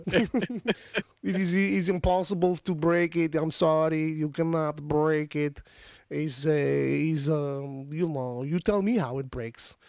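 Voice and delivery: hammed-up Russian insisting nothing broke; Russian accent. Host mimicking Chernobyl official in denial, Russian accent. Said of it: "I'm just doing Russian accents for no reason".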